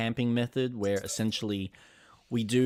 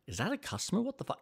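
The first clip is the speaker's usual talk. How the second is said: startled hushed alarm; 9 semitones higher, 7 dB quieter, much faster than usual. Retold or imitated. Alone closing, sees car.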